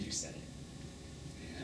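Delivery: slow, breathy, barely voiced; whispered reproach. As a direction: let it fall away slowly, hurt. Reproaching a lover's early "I love you.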